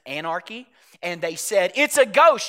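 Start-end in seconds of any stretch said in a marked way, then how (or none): 1.8-2.5 higher, louder retold cry of fear; mock terrified scream. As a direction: cry it out like a frightened fisherman. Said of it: "they screamed in terror".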